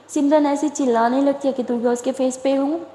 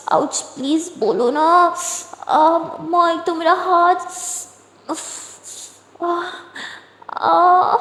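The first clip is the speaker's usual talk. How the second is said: higher ouch and aah, slowed; pained ouches; 5 semitones higher, much slower than usual. A narrator's reading. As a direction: wince between words. Hurt hand while pleading.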